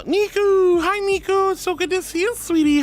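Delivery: high, gushing welcome; excited greeting. Real, not acted. Streamer spotting a favourite viewer in chat, excited. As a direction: sing out the name, then gush hello.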